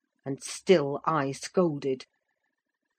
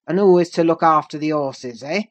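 loud gruff demand; angry demand; 7 dB louder than usual. A narrator's reading. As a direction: challenge him. Master demands.

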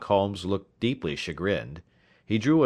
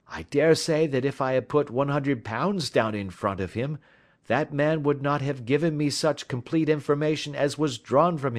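higher-pitched, lively Holmes explaining his trick; self-assured detective; 7 semitones higher than usual. A narrator's reading. Detective explaining the bet trick to his companion, self-assured. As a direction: speak as the confident sleuth.

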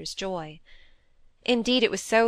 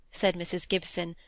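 ordinary delivery

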